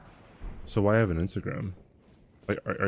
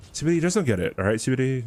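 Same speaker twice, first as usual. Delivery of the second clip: exasperated shout; 6 semitones higher, 4 dB louder, much faster than usual. Real, not acted. Exasperated explaining to chat.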